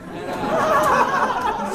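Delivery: high laughing squeak; laughing squeal. Real, not acted.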